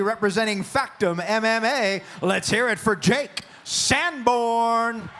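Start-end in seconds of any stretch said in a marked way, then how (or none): 2.2-5.1 slow, stretched crowd call; drawn-out hype call. Real, not acted. Announcer hypes crowd.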